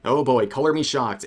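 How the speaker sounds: normal talking voice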